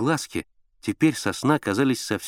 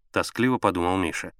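usual speaking voice

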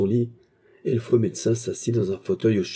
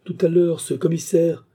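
high, rising protest, growing louder; agitated exclamation; 8 semitones higher, 4 dB louder than usual. A narrator's reading. Confused patient to maid, agitated. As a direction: protest in mounting disbelief. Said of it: "s'écria Baccarat".

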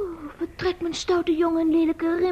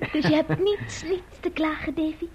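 usual speaking voice

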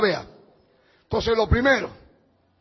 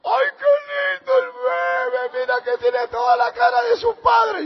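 much higher, louder, mock tearful gush; gushing mother-in-law; 13 semitones higher, 3 dB louder than usual. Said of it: "mi suegra".

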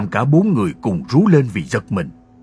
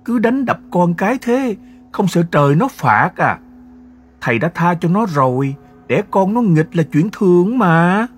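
high, louder woman's scolding voice; wife scolding her husband, high-pitched and loud; 9 semitones higher, 4 dB louder than usual. A narrator's reading. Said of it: "dỗ mỏ chen ngang".

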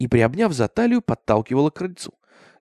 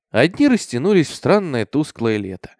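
ordinary delivery, nothing marked

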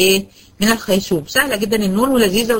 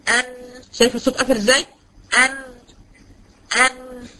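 nun hummed with the nose pinched shut; pinching nose; 2 semitones higher, 3 dB quieter than usual. Shown as a demonstration. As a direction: say ان holding the nose closed. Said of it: "بصي انا هسد الخيزوم عندي، انا هسد الانف".

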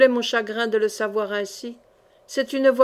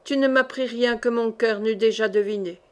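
plain reading voice

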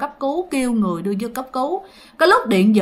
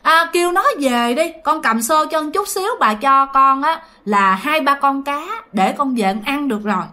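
put-on higher, eager pleading young girl; young cousin begging to come along, pitched higher; 4 semitones higher than usual. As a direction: beg your aunt to let you carry the bucket. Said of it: "mới nói với mẹ rằng á là".

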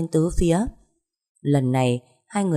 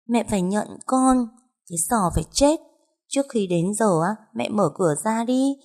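put-on high, eerie ghost child; voice from the underworld; 5 semitones higher than usual. Ghost child threatening the mother in the dark, eerily high. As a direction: demand it in a high, haunting spirit voice. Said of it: "giọng nói như từ âm ti dội về".

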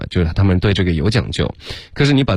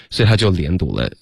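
ordinary talk

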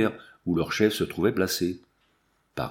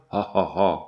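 low, deliberate narrated guffaw; mock burst of laughter; 4 semitones lower than usual. A narrator's reading. Warriors jeer at their queen being called a paleface, mock laughter. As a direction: act out the savages' derisive laugh. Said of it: "éclatant de rire".

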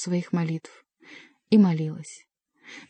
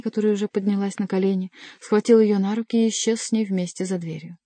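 ordinary delivery

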